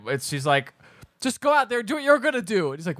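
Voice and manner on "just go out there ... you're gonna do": high, insistent imitation of a girl; mock urging. Urging the singer out on stage, mock insistence. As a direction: push them on in a high voice.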